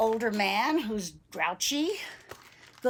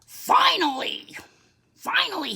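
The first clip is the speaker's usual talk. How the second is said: put-on grouchy old man; 8 semitones higher, 6 dB louder than usual. Old man reaching the cafe, exasperated. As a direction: grumble it loudly, falling at the end. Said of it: "the old man says".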